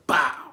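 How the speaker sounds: sudden booming "bow"; loud impact noise. Retold or imitated. Retelling pain hitting him in the lobby, explosive impact noise. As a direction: burst it out like a hard blow landing.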